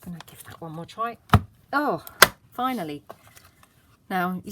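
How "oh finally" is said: loud relieved cry; relieved exclamation. Real, not acted. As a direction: burst of relief. Relief after struggle.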